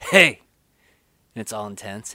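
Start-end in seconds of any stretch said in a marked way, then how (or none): 0.1-0.4 louder, higher sharp "Hey!"; half-played confrontational yell; mock angry shout. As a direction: get in his face with a snapped shout. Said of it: "he's just like".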